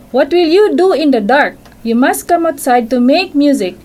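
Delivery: mother cricket telling her son off; scolding mother. Acted. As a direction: scold the little one like a cross mother. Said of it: "his mother scolded".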